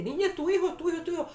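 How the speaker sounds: high, loud, urgent cries; imitated alarmed crowd. Onlookers telling the horrified mother it's her son, urgent mock cry.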